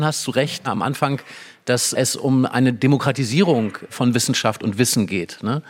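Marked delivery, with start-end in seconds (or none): none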